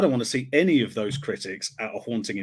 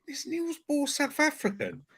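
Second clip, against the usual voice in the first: high, hushed incredulous question; baffled disbelief; 13 semitones higher, 7 dB quieter than usual. Retold or imitated. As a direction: pitch shoots up in mock bewilderment. Acts out own baffled reaction.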